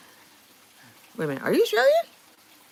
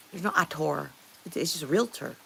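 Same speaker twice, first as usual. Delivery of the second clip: normal talking voice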